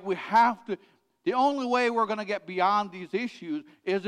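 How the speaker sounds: loud, high, driving sermon voice; full-force preaching. Acted. Pastor urging the congregation to open up, loud fervent preaching. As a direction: preach it out to the whole room.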